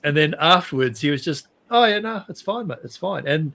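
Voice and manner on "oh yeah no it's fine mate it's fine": high, airy mock nonchalance; imitating an unbothered mate; breezy dismissal. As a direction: brush it off lightly, voice lifting then dropping away. Retold player shrugging off knock.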